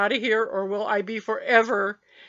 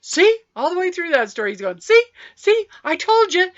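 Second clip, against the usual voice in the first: higher, louder gleeful I-told-you; triumphant; 10 semitones higher, 10 dB louder than usual. Retold or imitated. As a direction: crow it like you won the argument. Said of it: "He said".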